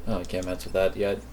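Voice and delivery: ordinary delivery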